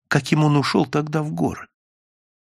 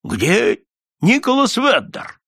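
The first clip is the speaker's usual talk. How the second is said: questioning; 8 semitones higher, slower than usual. A narrator's reading. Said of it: "потом спросил".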